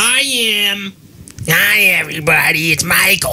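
goofy introduction in a put-on voice; put-on character greeting. Player announcing the most annoying person, put-on voice. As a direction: greet everyone in a silly altered voice.

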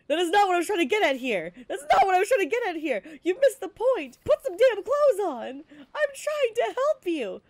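loud, high, fast scolding; exasperated big brother shouting. Acted. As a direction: yell at her to cover up. Brother scolding sister in skit.

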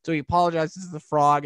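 ordinary delivery

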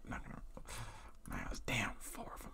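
breathy whispered curse; whispering. Real, not acted. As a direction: mutter it under your breath. Startled muttered curse.

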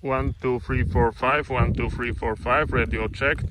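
normal talking voice

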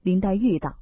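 plain reading voice

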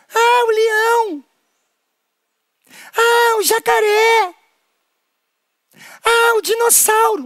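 high, slow hammed-up cries; high exaggerated childlike guesses; mock excited guesses. Guessing the dangerous animal.

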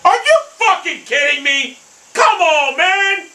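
high-pitched angry disbelief; exasperated outcry. Real, not acted. Gamer protesting a bad shot to the game, exasperated outcry.

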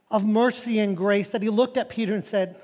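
ordinary talk, nothing marked

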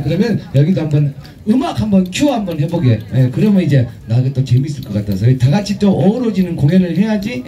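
ordinary delivery

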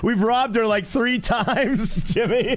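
high-pitched, laughing through the words. Real, not acted. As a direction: break up laughing at your own failure. Laughs at own repeated failed robberies.

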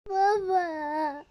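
sobbing call for dad; crying. Pretend. Daughter crying for her father, sobbing. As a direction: cry out for your father.

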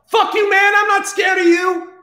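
high, loud acted defiance; theatrical angry bravado; defiant shout. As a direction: yell it straight at the big devil. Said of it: "Clive goes".

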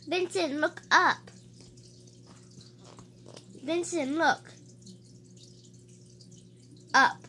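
small squeaky mouse calling up; little mouse voice. Acted. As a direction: call up like a tiny mouse. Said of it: "he heard a little mouth voice say".

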